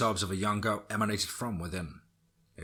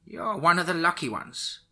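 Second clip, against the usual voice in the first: put-on old witch voice; 9 semitones higher, 6 dB louder, slower than usual. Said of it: "said the old witch".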